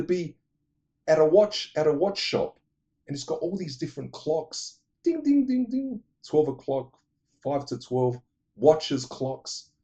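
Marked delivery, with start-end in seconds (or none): 5.0-6.0 high mock chiming dings; clock chimes. Describing the watch shop scene, high imitated chimes. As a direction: chime each ding like a clock.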